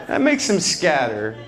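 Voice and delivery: normal talking voice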